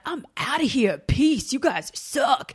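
punchy, drawn-out mock defiance; defiant kiss-off. Young Southerner quitting the South, defiant. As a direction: act out a young rebel storming off.

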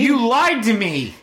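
high, lightly hurt "you lied" voice; mock betrayed woman. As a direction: act betrayed, a touch hammed-up. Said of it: "She felt betrayed".

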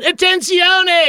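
high drawn-out call of Attenzione; mock panicked Italian yell; retold yell in Italian. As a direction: stretch Attenzione slow and high like a panicked alarm. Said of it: "the only thing I could yell".

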